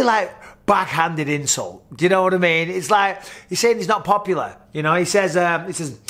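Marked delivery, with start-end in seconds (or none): none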